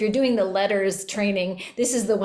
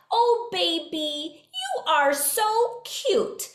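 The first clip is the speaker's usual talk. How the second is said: put-on much higher, very slow sing-song baby talk; motherese; 8 semitones higher, much slower than usual. Shown as a demonstration. Mother cooing at her baby, high slow sing-song. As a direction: melodic cooing to an infant. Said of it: "let's just call it motherese".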